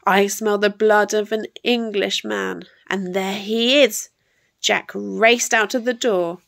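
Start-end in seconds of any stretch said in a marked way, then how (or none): none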